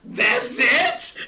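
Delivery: high-pitched, incredulous outburst; indignant disbelief. Retold or imitated. Let-down traveler to guru after the long trip, indignant disbelief. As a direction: blurt it out as an outraged question.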